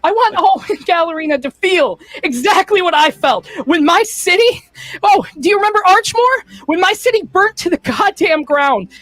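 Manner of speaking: high and loud, bitter; villain tells Alwyn of her burnt city; vengeful monologue. Acted. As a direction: spit the grievance at Alwyn. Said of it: "The villain's monologue".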